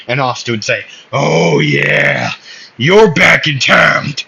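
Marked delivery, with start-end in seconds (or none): 1.1-2.3 a lightly voiced low swagger; character taunt. Asta greeting his rivals in battle, lightly acted low taunt. 2.8-4.1 loud, high character call; rowdy taunt. Acted. Asta greeting his rivals in battle, loud rowdy taunt.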